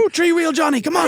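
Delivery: high squeaky falsetto cheering; mock racegoer cheer. A pretend racegoer cheering on three wheel Johnny, high squeaky cheer. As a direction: egg on the horse in a silly high voice. Voicing spectator cheering a horse.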